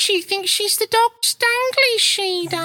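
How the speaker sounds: very high, squawking put-on voice; mocking gossip. Demonstrating the slang as a catty onlooker, mocking squawk. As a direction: squawk it high and scornful, drawing words out. Mimicking someone sneering at a show-off.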